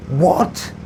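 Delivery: high, loud questioning shout; calling out loudly. A narrator's reading. Narrator missing the words in traffic din, loud call. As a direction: shout it, unable to hear. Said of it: "I called out loudly".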